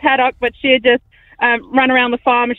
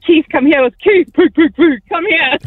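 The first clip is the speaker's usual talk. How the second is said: raised, higher farmyard call; pig-calling voice; 5 semitones higher, 3 dB louder than usual. Shown as a demonstration. Farmer showing how she summoned Keith Kong, loud pig call. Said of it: "how did you talk to Keith Kong".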